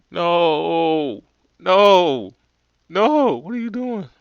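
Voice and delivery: loud, rising string of noes; frantic shouted noes. Acted. Stopping the stove stunt.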